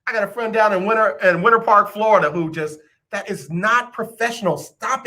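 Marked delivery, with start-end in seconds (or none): none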